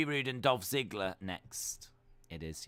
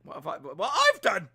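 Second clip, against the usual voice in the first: a bit louder, indignant; mock defensive reply; 7 semitones higher, 8 dB louder than usual. Streamer answering his own scolding voice, mock indignant. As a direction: answer back to yourself indignantly. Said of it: "Getting into an argument with myself".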